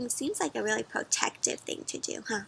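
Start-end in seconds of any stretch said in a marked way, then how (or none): none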